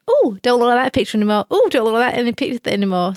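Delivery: normal talking voice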